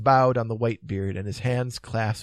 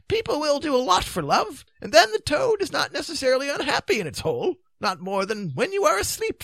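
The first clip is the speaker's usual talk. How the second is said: high-pitched wizard voice; high, theatrical Merlin voice; Merlin, matter-of-fact; 5 dB louder than usual. Acted. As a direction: answer high, as old Merlin. Merlin answers in character.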